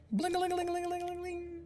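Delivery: high, fast tinkling lute noise; twinkly magic sparkle. Retold or imitated. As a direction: sing out a sparkly string run. Imitating lute flourish.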